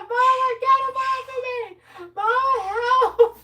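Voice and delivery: high loud pleading yell; calling mama for help. Retold or imitated. Parrot copying a boy begging mom, high loud yell. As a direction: beg mom for help at the top of the voice. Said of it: "He just kept calling".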